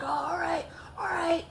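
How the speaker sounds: high mock-threatening acknowledgement; retold challenge. Friend's challenge before fight.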